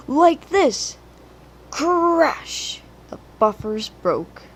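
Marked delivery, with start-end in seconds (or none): 0.1-1.0 loud eager boast; excited boast. A narrator's reading. Thomas boasting. 1.7-2.8 dramatic crash. A narrator's reading. Buffers break.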